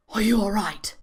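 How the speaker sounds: ordinary delivery, nothing marked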